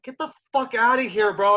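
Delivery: high, loud retold brush-off; scoffing disbelief. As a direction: blurt it out, not buying a word. Retold disbelief at the instructor's promise.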